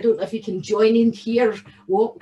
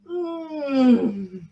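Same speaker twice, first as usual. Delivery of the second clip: savouring hum; 2 semitones higher than usual. Acted. Savouring cheese.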